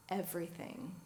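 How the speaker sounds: soft, low single word; a gentle, hushed answer; said quietly. Retold or imitated. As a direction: say it simply and quietly. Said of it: "he said it quietly".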